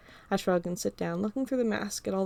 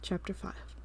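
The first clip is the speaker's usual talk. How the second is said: plain reading voice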